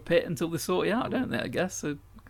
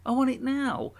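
whiny impatience; 11 semitones higher than usual. Pretend. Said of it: "And you'd be like".

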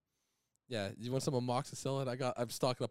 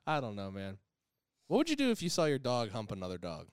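ordinary talk, nothing marked

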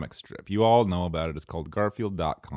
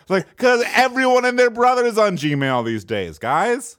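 exasperated rant; 13 semitones higher, 7 dB louder than usual. Retold or imitated. Mock-exasperated rant about Gmail.

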